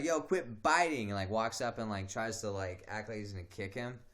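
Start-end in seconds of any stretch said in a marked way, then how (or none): 0.0-1.1 higher, louder mock shout; imitating friend's shout. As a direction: bark it at him from the side. Friend stepping into the fight.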